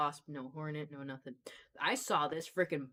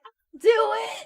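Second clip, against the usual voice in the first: put-on high, loud, slow little girl; child voice; 13 dB louder, much slower than usual. A little girl insisting on paying, high loud child voice. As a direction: insist like an eager toddler. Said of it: "the little girl was like".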